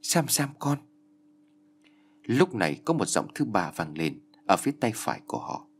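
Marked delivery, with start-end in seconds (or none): 0.0-0.8 mother's higher-pitched call to her daughter; raised voice calling a name. A narrator's reading. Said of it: "giọng của bà Kham Sô cũng cất lên".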